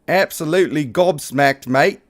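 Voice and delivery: lightly put-on Australian accent; a Brit trying to sound Aussie; playful, half-hearted Australian accent; mock Australian accent. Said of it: "I sound like a Brit trying to be Aussie".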